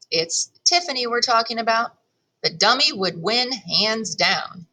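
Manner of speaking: plain reading voice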